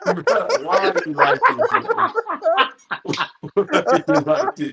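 high-pitched laughing disbelief. Real, not acted. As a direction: laugh through the words, loud and high. Teasing friend about old video.

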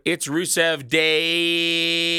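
very slow, stretched "Day" for all the Ys; drawn-out tweet. Retold or imitated. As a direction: drag the last word out endlessly. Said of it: "twenty Ys at the end".

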